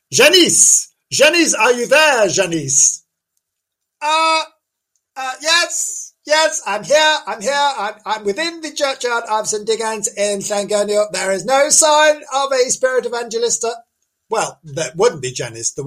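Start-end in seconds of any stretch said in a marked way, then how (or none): 0.1-3.0 loud, high call down the line; hammed-up calling for someone. Calling to correspondent on the spot. 4.0-13.7 high-pitched comic lady reporter; fluting on-the-spot correspondent, put on; Janice. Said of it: "we were gonna talk to Janice".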